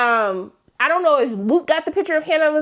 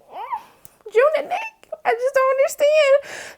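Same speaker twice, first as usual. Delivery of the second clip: high whiny mock sob, slow at the end; mock crying; 12 semitones higher, slower than usual. Fan moved to tears by the couple, high mock crying whine. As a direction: wail it like you're about to cry. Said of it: "And I was just like".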